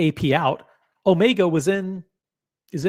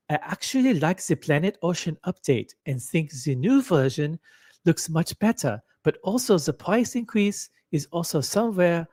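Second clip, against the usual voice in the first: put-on German accent, slightly raised pitch; 3 semitones higher than usual. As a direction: read it out in an amateur German accent. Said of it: "I'll try with German accent".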